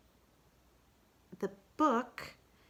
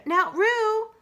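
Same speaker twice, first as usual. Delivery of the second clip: much higher, louder call; Kanga calling Roo; 10 dB louder than usual. Acted. Kanga calls Roo, much higher. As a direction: call firmly to your child.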